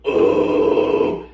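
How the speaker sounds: loud raspy growl, vowel changed; vowel-shifted growl. Shown as a demonstration. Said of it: "mess around with vowels".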